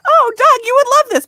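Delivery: high, loud, gushing call to her husband; mock excited call. Calling to husband about what she sees, high loud excited squeal. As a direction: squeal it up high like a delighted tease. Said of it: "I was like".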